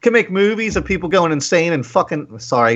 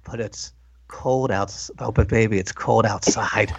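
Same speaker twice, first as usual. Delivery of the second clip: creepy man voice; 5 semitones lower than usual. Acted. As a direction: lean in like a leering seducer. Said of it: "in my most creepy man voice".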